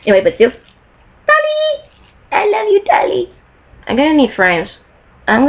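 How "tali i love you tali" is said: high, drawn-out fangirl coo; adoring coo. Pretend. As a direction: coo lovingly at your idol, very high. Cooing at Tali picture.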